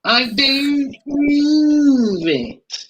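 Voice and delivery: drawn-out, high-pitched exasperated disbelief; Victor Meldrew. Acted. Podcaster doing a catchphrase for co-host, Victor Meldrew impression. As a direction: stretch BELIEVE way out, high and incredulous. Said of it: "I am not Victor Meldrew".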